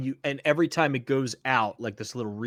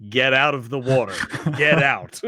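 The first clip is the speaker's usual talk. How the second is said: loud, raised-pitch yelled warning; mock yell; 9 dB louder than usual. Reading a viewer's chat message aloud, mock yell. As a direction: yell it like a warning, half laughing. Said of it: "in the chat is yelling".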